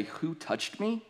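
high mocking rise on the question; sarcastic. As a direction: toss it back as a sneering question. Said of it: "I can hear the sarcasm in their voice".

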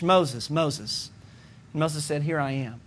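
hushed calling. Acted. God calls Moses softly.

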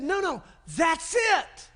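Jesus praising Peter's answer, elated shout; excited approval. Retold or imitated. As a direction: cheer him on, rising. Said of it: "And Jesus said".

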